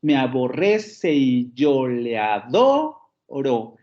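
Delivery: "Me aborrece y yo le adoro" slow, RRE and DO rising; drawn-out "a-bo-RRE-ce y yo le a-DO-ro"; stress on sixth and tenth syllables. Shown as a demonstration. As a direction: scan slowly, holding and lifting RRE and DO. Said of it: "el último vuelve a caer en la décima sílaba".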